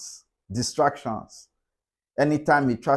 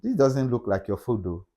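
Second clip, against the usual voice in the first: gentle correction; 6 semitones lower, 5 dB quieter than usual. Shown as a demonstration. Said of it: "You say".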